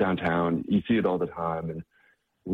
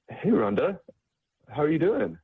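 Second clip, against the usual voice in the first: bright, much higher friendly hello; friendly greeting; 10 semitones higher than usual. Retold or imitated. John greeting Rhonda on the street, upbeat and high. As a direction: call out her name cheerfully.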